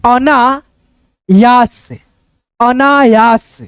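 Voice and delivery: अनायास very slowly, अना-यास; syllable-by-syllable reading. Shown as a demonstration. Teacher pointing at the word, अनायास read slowly in parts. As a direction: stretch the long vowels of अनायास. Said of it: "यहाँ पे देखो".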